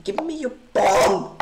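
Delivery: loud, demanding outburst; screaming. Real, not acted. Streamer demanding the opponent's pawn, screaming. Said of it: "for screaming".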